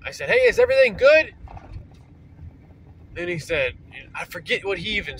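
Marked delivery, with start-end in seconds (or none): 0.3-1.3 loud, high call down the road; yelled out. Retold or imitated. Calling out to his brother down the dark road, loud yell. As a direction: shout it out to someone far away. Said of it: "So I yelled out".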